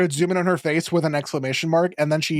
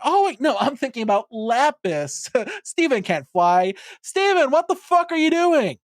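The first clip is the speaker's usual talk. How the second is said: imitating Amethyst, higher and rising to a yelp; sudden realization, pitched up high; mock alarm; 8 semitones higher than usual. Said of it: "She's like".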